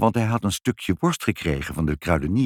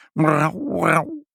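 higher mock cat meow; a higher playful imitated meowing; 7 semitones higher than usual. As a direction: meow like two cats. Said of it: "zeiden ze".

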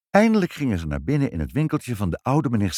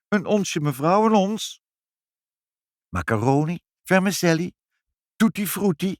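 high, sing-out sales pitch; eager shopkeeper; 10 semitones higher, 3 dB louder than usual. Acted. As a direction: call out the wares to a customer. Shopkeeper calling out his wares. (hoog aanprijzende winkelier)

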